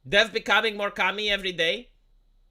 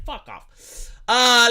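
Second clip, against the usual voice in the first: ordinary talk, nothing marked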